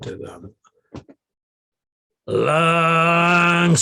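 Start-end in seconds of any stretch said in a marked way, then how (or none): 2.3-3.8 higher barked order; officer turning the squad, shouted command; drill command shout. Retold or imitated. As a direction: call the turn like a drill sergeant.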